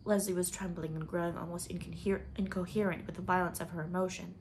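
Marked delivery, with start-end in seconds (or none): none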